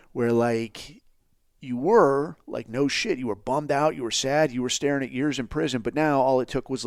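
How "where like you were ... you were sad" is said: ordinary talk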